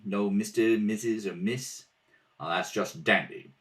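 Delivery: ordinary talk, nothing marked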